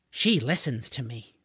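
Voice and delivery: usual speaking voice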